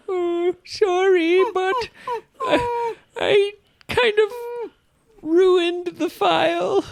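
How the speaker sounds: high, whiny apologetic puppy voice; guilty whimper. Pretend. Confessing guilty mistake, whimpering.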